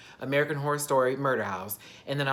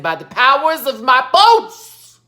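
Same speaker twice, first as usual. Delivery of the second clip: hammed-up battle cry, very loud and high; Mallory; 12 semitones higher, 15 dB louder, slower than usual. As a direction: bellow it slowly like casting a mighty spell. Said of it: "Then Mallory said".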